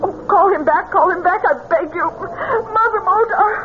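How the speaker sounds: high, crying plea; mother begging the medium, high and tearful; desperate pleading. Acted. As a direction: beg through tears to bring him back. Mother begs medium to return.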